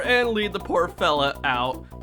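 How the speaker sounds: usual speaking voice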